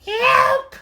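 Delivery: very high, loud yell; panicked cry. A narrator's reading. Cats crying out, very high and loud. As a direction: yelp for help. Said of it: "cried the cats".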